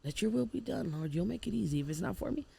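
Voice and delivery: retold prayer, softly under the breath. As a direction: pray under your breath, surrendering. Retold quiet prayer in truck.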